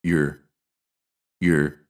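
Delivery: "your" said as a quick "yer" with schwa; weak, unstressed "your" as "yer"; clipped "your" with an er vowel; reduced your. Shown as a demonstration. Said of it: "it becomes".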